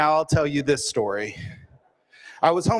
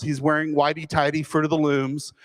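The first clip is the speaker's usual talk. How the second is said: ordinary talk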